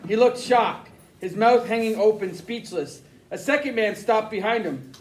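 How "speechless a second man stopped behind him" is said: ordinary delivery, nothing marked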